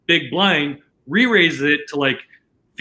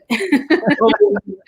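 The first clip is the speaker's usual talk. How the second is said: high laugh; 10 semitones higher, 3 dB louder than usual. Real, not acted. Laughing at Phil's bar bill.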